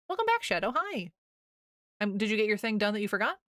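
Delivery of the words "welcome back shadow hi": excited greeting. Real, not acted. Greeting returning chatter.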